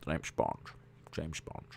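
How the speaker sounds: low, soft Scottish "James Bond"; a hushed Scottish Bond voice; Sean Connery impression. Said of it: "Sound like Sean Connery".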